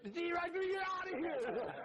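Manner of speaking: a gleeful sidekick sending them off; laughing mock squeaky aide; little guy's voice. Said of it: "and I heard".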